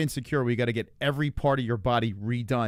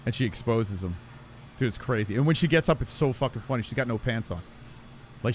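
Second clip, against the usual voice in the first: ordinary delivery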